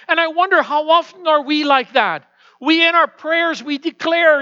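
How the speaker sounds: rising, loud preaching build; preaching at full force. Acted. Pastor rousing the congregation, loud fervent preaching. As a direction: preach it with mounting fervor.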